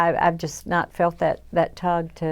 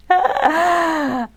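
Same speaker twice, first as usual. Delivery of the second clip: a high burst of laughter; bright laugh; 11 semitones higher, 4 dB louder than usual. Real, not acted. Laughing at her own mock platform, high and bright.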